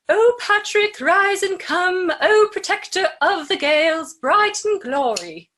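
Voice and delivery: put-on high, loud sung-out British accent. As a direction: proclaim it like a choir of angels in a British accent. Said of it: "not a British accent".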